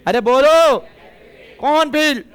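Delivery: calling out loudly to the class; loud teacher prompt. Real, not acted.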